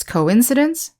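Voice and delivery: "coincidence" stressed on IN, tune rising; co-IN-cidence, rising as first in a list; second-syllable stress. Shown as a demonstration. Teacher recapping the word forms, stressing IN in "coincidence". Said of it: "the stress is on the second syllable".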